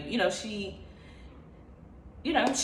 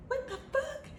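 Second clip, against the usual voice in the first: much higher, freaked-out squeak; high squeal of disbelief; 10 dB quieter than usual. Retold or imitated. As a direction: squeak it out, tripping hard. Stunned while driving, seeing animations.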